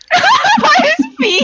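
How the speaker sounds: high laughing question. Real, not acted. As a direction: laugh through the words in disbelief. Laughing disbelief at the plan.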